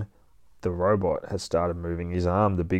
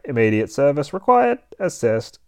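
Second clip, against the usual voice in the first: higher, louder robot readout; little robot alert voice; blue robot; 6 semitones higher, 4 dB louder than usual. Acted. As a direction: report like a small machine in need of service. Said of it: "says the, um, blue robot".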